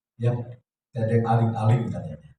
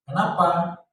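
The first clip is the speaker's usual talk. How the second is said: KENAPA pitched up, tensely; rising intonation; 5 semitones higher, slower than usual. Acted. Spouse starting a quarrel, KENAPA with raised intonation. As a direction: lift KENAPA higher like a challenge. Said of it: "Omongnya mulai ada intonasi naik".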